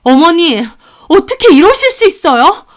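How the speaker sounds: high, loud, hurt protest; shocked. Acted. Narrator protests being shoved by mother-in-law, shocked. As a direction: appeal in shocked disbelief. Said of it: "제가 충격받아서 말하자".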